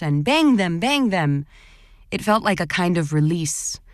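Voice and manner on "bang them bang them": mock protest chant, pitch jumping up then falling; half-voiced rallying cry; imitated yelling. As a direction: call it out like a crowd egging itself on. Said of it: "You could hear voices yelling".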